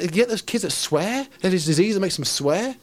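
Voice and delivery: ordinary delivery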